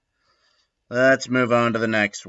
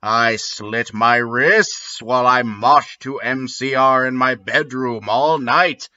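ordinary delivery

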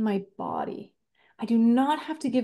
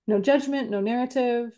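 normal talking voice

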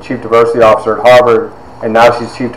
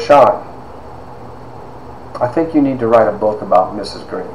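lightly voiced measured pronouncement, softer; Maya Angelou; 6 dB quieter than usual. Famous author advising student to write a book, lightly imitated gravity. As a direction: pause after the name, then advise deliberately. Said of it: "she does exactly like this".

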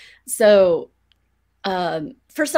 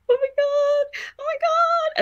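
much higher, squealing half-laugh; giddy squeal. Retold or imitated. Wandering the horror house at night, giddy squeal. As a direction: squeak it like you can't contain yourself. Acting her wired night self.

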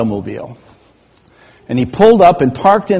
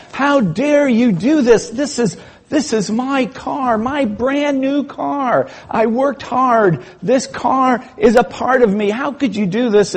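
mock outrage; 7 semitones higher than usual. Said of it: "started yelling at the guy".

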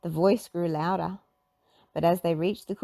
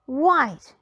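high loud finding; Velma voice; 6 semitones higher, 6 dB louder than usual. A narrator's reading. Velma inspecting Scooby's paws, loud and sure. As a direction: announce the clue. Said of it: "she said".

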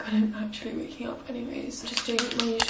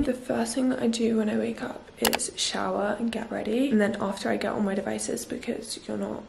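ordinary delivery

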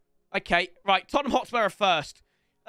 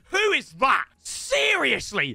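angry shouting; 10 semitones higher, slower than usual. Real, not acted. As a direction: yell at the player who lost the ball. Teammate loses ball, angry rant.

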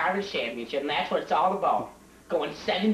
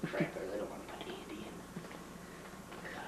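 whispering to himself; 11 semitones lower, 17 dB quieter than usual. Acted. Coach mutters to himself.

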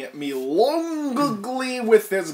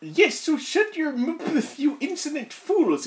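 mock villain voice; 10 semitones higher, slower than usual. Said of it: "yelled Professor McGoggle".

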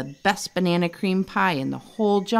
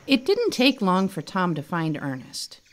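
ordinary delivery, nothing marked

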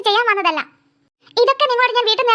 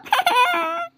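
laugh; 7 semitones higher, 3 dB quieter than usual. Acted. Mocking laugh at sulking child.

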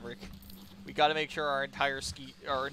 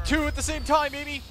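high, loud whoop of triumph; excited yell; 9 semitones higher, 9 dB louder than usual. Real, not acted. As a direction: crow it loudly over the blast. Celebrating a double cannon shot.

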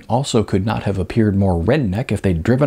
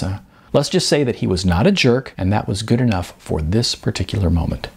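plain reading voice

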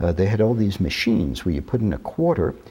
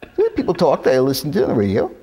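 put-on broad New York accent, much higher and loud, quickening; 4 dB louder, faster than usual. Young student protests to his professor in a thick New York accent. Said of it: "where they talk like that".

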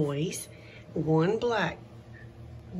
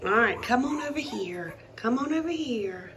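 usual speaking voice

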